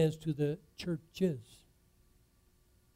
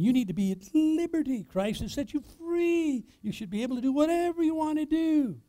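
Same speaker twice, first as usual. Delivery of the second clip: raised high, taunting the world's argument; mock worldly claim; 10 semitones higher than usual. Preacher mimics the world's excuse, high and mocking. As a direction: parrot the libertine line, pitched up.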